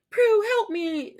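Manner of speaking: very high, slow mock plea; pleading Piper. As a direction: whine for help. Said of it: "She was just like".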